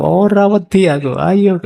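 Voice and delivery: high, loud challenge in Javanese; frightened yell in Javanese. Retold or imitated. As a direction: bluster loudly in Javanese to cover fear. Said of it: "sambil teriak keras-keras". (teriakan keras dalam bahasa Jawa karena takut)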